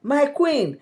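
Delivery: high, loud exclamation of disbelief; acted shock, pitched right up; wide-eyed astonishment. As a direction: react astonished at what you just heard. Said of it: "Eyes were open, were like".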